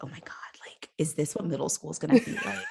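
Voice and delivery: unvoiced, hushed, with a laugh creeping in; awed whisper. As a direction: whisper it like a kid who just saw something forbidden. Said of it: "I was like".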